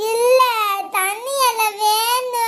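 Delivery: high, very slow sobbing demand; crying tantrum. Acted. Child refusing mother's feeding, sobbing tantrum. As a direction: whine through tears for your own leaf.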